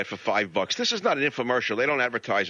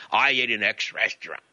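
mock restaurant snob. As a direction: imitate a boastful diner, mockingly.

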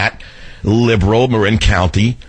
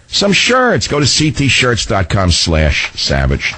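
lower, easy pitchman read; radio ad read; 4 semitones lower than usual. Acted. Host selling shirts to his listeners, smooth pitchman tone. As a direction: sell the shirts like a friendly tip.

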